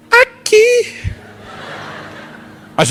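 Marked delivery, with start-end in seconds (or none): 0.1-0.9 put-on very high, squeaky child's whimper; whiny little boy. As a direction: squeak it like a hurt toddler. Son shows his arm.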